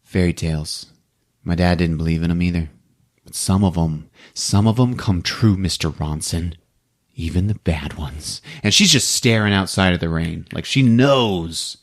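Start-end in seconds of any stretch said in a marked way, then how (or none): 0.0-8.4 low, soft, hollow murmur; despondent. Retold or imitated. Kirsty telling the detective some fairy tales come true, despondent. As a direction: answer with no hope left. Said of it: "She's all despondent".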